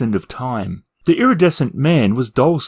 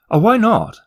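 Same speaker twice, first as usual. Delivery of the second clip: curious question; 10 semitones higher than usual. Acted. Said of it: "inquired Two Flower".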